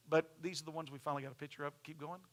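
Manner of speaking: normal talking voice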